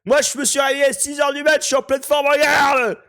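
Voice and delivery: high, loud, boastful shouting; excited shout. Retold or imitated. Mocking boast after Jojo impression.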